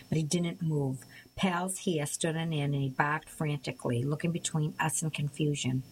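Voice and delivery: ordinary delivery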